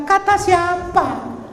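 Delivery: very high, drawn-out challenge; exaggerated incredulous question; incredulous retort. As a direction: stretch it out in high-pitched mock disbelief. Acted retort to mother's claim.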